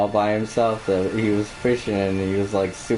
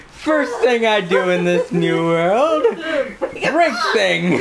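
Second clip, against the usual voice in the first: giggling high through the words; cracking up while talking; laughing; much slower than usual. Real, not acted. Gleeful after breaking something.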